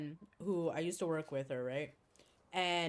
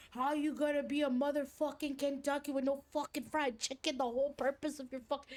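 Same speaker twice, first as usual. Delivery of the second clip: higher-pitched mock tirade; imitated cussing rant; 6 semitones higher than usual. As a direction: run on in retold, sweary indignation. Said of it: "she's cussing".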